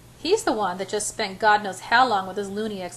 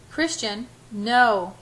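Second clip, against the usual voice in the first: ordinary delivery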